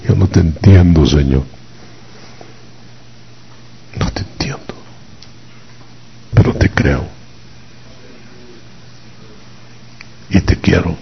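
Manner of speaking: understated acted weeping, fading to a near whisper; tearful plea. Mary at Jesus' feet, understated tearful plea. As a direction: plead softly with halting pauses.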